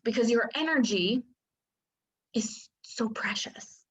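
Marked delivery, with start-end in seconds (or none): 2.3-3.8 a sudden whispered aside; hushed whisper. Acted. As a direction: whisper it, soft and secret. Hushed aside on precious energy.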